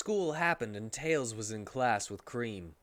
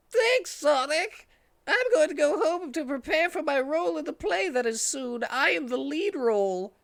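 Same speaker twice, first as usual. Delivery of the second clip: much higher, squeaky put-on voice; Charmy character voice; 6 dB louder than usual. Charmy thanking Sonic after rescue, high squeaky voice.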